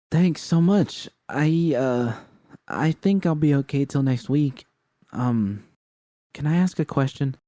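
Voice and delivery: timid new hire asking carefully; shy young woman. Acted. Narrator voices the shy woman.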